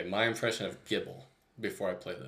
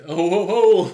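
loud hearty ho ho ho; Santa laugh; 8 semitones higher, 13 dB louder, slower than usual. Retold or imitated. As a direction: belt out a jolly Santa laugh. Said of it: "It sound like".

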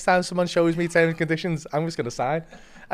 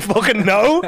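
laughing; 4 semitones higher, 7 dB louder, slower than usual. Real, not acted. Owning up to not reading forms.

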